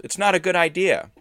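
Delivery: high, loud objection; yelled protest. A narrator's reading. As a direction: keep yelling to talk them down. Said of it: "Edith yelled".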